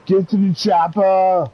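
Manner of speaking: high, slow action movie bark; action hero shout. Pretend. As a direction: bellow it like a movie tough guy. Movie line shouted in put-on voice.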